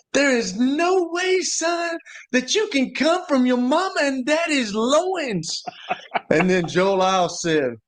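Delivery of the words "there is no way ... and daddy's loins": high, slow, strained mock yell of an old coach; imitating a coach yelling. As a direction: bellow it like a furious old football coach. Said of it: "Coach Donnelly yelled at Joe Lau and said".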